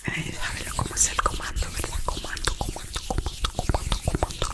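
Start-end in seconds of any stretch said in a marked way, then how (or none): 2.0-4.5 whispered name repeated as an ASMR trigger; repeated trigger word. Shown as a demonstration.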